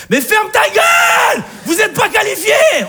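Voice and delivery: furious mock shout. Comedian shouting at the fans in mock fury. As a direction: scream at them to shut up.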